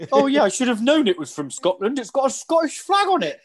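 high, dopey mimicking voice; mocking imitation. Mocking the friend's podcast line in a high dopey voice. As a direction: parrot his friend as a clueless fool.

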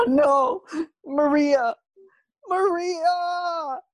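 high, hammed-up crying wail; mock wailing. Fernando, dragged off to jail, wails for Maria. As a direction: wail her name in fake despair.

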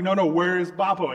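freaking out. Retold or imitated. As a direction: act out a parent's panicked insistence. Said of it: "my dad is freaking out".

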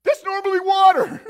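as if the ground just appeared under his feet; stunned Peter. Acted. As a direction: Peter gaping at standing on the water. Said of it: "he's like".

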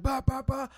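honking noises. Retold or imitated. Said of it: "So I'm like".

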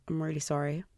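ordinary delivery

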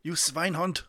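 mock German insult. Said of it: "it had a hint of German".

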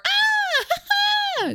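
high giggly fake laugh; a shrill-free playful "ah ha ha"; mock silly laugh. Shown as a demonstration. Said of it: "there's no room for".

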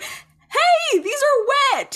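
high-pitched, loud mock protest; indignant outburst. Spike complains as Buffy barges into his crypt, indignant yelp. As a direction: cry out in hammed-up indignation. Acting out a favourite indignant moment.

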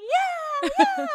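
very high, loud mock shriek; piercing high-pitched mom cheer; shrieking Filipino mom. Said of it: "shrieking as little Filipino moms do".

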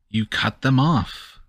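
ordinary talk

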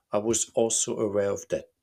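usual speaking voice

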